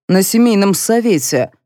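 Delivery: plain reading voice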